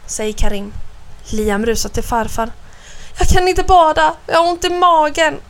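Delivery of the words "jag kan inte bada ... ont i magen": high, urgent complaint; anxious child. A narrator's reading. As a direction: plead to be let off. Frightened boy runs to grandfather. (oroligt barn som klagar)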